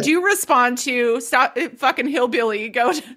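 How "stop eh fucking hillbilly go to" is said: giggling through the words; can't keep a straight face; laughing quote. Real, not acted. Laughs while quoting.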